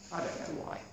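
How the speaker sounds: breathy aside, barely voiced; soft whispered echo. Shown as a demonstration. Repeated line whispered.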